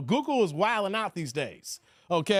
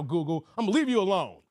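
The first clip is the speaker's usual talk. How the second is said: normal talking voice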